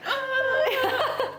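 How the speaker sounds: high strained laughing groan; mock struggle cry with a laugh. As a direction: squeak out a strain under heavy stones. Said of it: "you're like".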